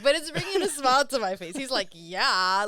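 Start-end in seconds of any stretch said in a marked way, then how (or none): 1.9-2.7 loud, keen "yeah"; emphatic retold agreement; really enthusiastic. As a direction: imitate an eager server agreeing. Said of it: "he's really enthusiastic".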